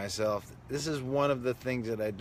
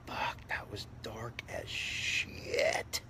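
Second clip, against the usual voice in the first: slow stunned whisper; 6 dB quieter, much slower than usual. Real, not acted. Remembering Ren and Stimpy, stunned slow whisper. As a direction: breathe it out slowly, aghast.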